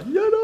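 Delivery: long, loud, very high mock howl; drawn-out piercing falsetto cry ending in laughter; high falsetto wail. YouTuber joking that it sickens viewers, wailing in falsetto. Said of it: "quand je fais ça".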